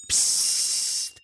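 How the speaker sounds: soft, voiceless shushing; frightened whisper. Acted. Startled squirrel hushing the hare, whispered. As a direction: hush the other with a long hiss. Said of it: "flüsterte das erschrocken".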